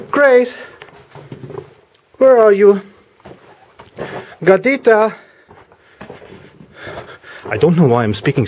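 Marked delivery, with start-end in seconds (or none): 0.1-2.8 calling out. Real, not acted. Calling the cat around the house. 4.4-5.2 high sing-song call for the cat. Real, not acted. Calling the cat in Spanish.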